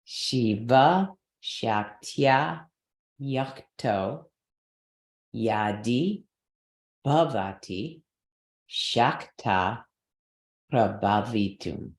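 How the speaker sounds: phrase by phrase, slow, each chunk lifted; slow recitation together. Shown as a demonstration. Teacher reciting with students, slow and stressed. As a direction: lead the class through the verse. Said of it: "You wanna try it together?".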